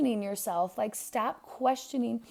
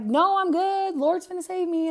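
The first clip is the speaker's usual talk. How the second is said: stubborn refusal; 8 semitones higher, 5 dB louder than usual. Retold or imitated. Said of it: "he's like".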